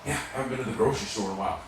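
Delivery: quick, soft cashier quip; fast. Retold or imitated. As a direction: toss it off quickly under the breath. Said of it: "looks at her and says".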